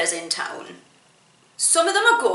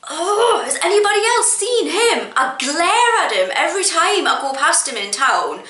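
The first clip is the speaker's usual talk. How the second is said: exasperated rant; 7 semitones higher, 3 dB louder than usual. Real, not acted. Ranting about hated street beatboxer.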